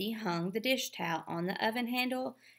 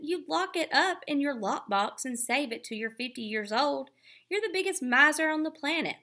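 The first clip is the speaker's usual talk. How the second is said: higher, louder, fast jeer; mocking insult; 7 semitones higher, 4 dB louder than usual. A narrator's reading. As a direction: rattle off the insult with scorn. Evan insulting sister.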